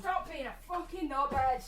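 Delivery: high-pitched mock telling-off; shouting at the dog. Shown as a demonstration. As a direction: demonstrate scolding a barking dog. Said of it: "shout the dog, go on".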